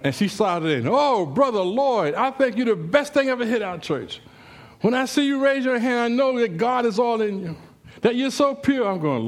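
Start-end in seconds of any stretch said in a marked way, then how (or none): 0.9-8.9 high, effusive non-stop praise; gushing church lady. Acted. Church lady praising the preacher in the store, gushing. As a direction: gush at a higher pitch like an admiring churchgoer. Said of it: "could talk for, like, five hours".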